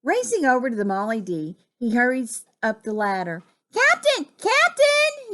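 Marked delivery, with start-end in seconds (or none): 3.7-5.3 high, loud hail for the captain; calling out. Acted. Frog knocking on the captain's cabin door, calling out. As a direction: call out in a high, urgent voice. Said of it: "he called".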